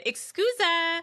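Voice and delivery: put-on high, playful Italian accent. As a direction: toss it off in a thick Italian accent. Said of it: "like, a thick Italian accent".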